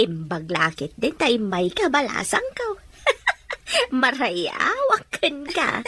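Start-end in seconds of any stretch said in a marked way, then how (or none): 3.0-4.0 a high laugh with a catch of tears; high teary laugh. Acted. Mother laughing teary-eyed with the neighbour girl. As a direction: laugh until it almost cracks. Mother chatting warmly with young woman.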